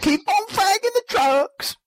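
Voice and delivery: put-on hillbilly accent. Repeats sign-off in accent.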